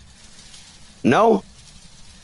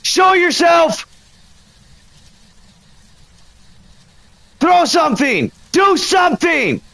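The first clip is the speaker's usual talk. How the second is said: provoking shout; 11 semitones higher than usual. Real, not acted. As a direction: demand the ghost act, yell it out. Provoking the ghost.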